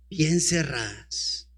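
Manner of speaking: bien cerradas said low, soft and slow, falling on bien; low soft answer. Shown as a demonstration. Preacher answering his own question, low quiet emphasis. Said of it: "¿Y las puertas estaban cómo?".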